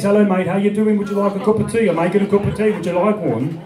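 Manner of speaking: broad, chatty Midlands dialect; British Midlands accent. Acted. Showing how Shakespeare's home region talks, Midlands accent. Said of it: "They speak like this".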